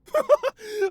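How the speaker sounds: a sudden loud, high burst of laughter. Acted. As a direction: crack up loudly at your own joke. Delighted burst at own joke.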